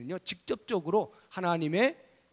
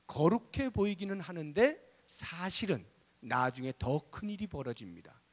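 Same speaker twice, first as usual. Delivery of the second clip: ordinary delivery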